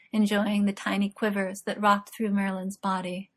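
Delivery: ordinary delivery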